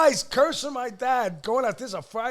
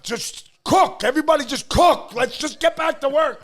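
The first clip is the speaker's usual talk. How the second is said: commanding the kitchen, louder and higher; retold command; 6 semitones higher, 5 dB louder than usual. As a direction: take charge and get everyone working. Son ordering kitchen staff.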